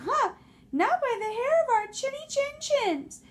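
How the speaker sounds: high, loud squeaky pig; defiant squeal of the little pigs. Acted. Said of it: "the little pig said".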